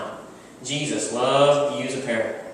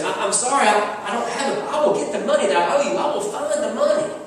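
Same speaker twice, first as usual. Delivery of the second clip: higher, rushed panicky pleading; desperate begging; 9 semitones higher, 4 dB louder than usual. Acted. Servant begging his fellow servant, high and panicky. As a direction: stammer and beg for mercy. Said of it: "begins to beg".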